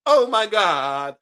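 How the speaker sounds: put-on loud, slow little boy gasp; little boy's dramatic exclamation; slow drawn-out kid exclamation. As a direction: stretch out oh my God loudly like a boy. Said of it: "the little boy goes".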